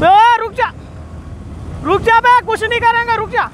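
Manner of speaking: high pitched shout to stop; calling out. Real, not acted. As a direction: call him back, promising no harm.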